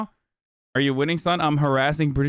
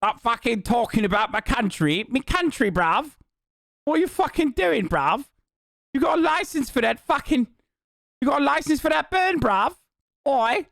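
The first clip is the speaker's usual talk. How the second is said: high-pitched, hammed-up angry Brit; mock British accent; 12 semitones higher than usual. Offended Brit threatening to shank the streamer, mock British accent. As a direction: escalate into absurd high British outrage. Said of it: "I'm doing a British accent".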